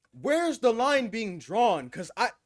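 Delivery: slow, loud, high disbelief; shocked disbelief. Real, not acted. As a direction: drag it out in shock. Reacting in disbelief to clip.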